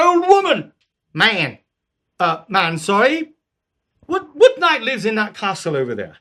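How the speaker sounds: playful Python king; King Arthur. Acted. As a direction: call down from the horse, lightly voiced. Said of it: "start off with the Englishmen walking up".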